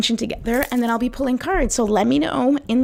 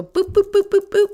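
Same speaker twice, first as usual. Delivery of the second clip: higher imitated battery beeps; boop sounds; 12 semitones higher, 4 dB louder than usual. Shown as a demonstration. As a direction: beep it like a battery light. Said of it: "like a battery light".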